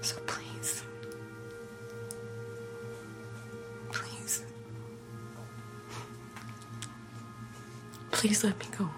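very soft, whispered plea; tearful begging. Acted. Captive begs captor to let her go, tearful whisper. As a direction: beg in a halting whisper. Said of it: "Tears streak Anna's cheeks".

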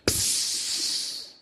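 long drawn-out hiss; imitated hissing noise. Man repeating the noise he heard, hissing. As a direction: mimic a spray with the mouth. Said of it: "That's what I heard".

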